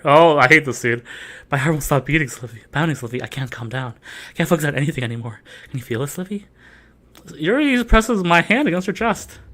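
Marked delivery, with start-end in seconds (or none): 1.5-6.5 much softer, low and intense. Acted. [name] confessing to [name], soft and intense. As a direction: confess a racing heart breathlessly.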